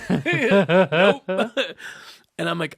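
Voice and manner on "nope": laughing. Retold or imitated. Cousin's blunt answer, laughing.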